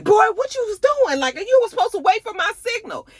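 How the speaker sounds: high-pitched exasperated telling-off; mock scolding. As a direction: demand an explanation in a high, pushed voice. Acted quote of woman scolding him.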